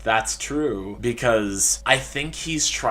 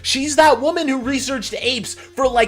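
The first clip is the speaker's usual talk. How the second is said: much higher, louder disbelieving rant; incredulous outrage; 10 semitones higher, 8 dB louder than usual. Acted. Researcher baffled by an odd source, high incredulous outburst. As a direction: demand answers in high-pitched bafflement.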